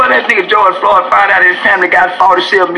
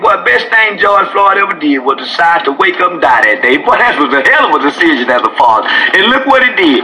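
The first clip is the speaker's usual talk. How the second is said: ordinary delivery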